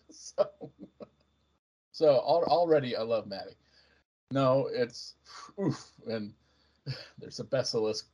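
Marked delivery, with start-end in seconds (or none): none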